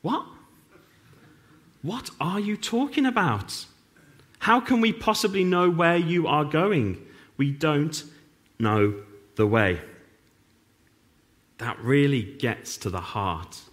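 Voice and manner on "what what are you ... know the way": higher, incredulous what-are-you-on-about; baffled Thomas. Retold or imitated. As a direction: blurt it out like the confused disciple. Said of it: "Thomas is the only one who goes".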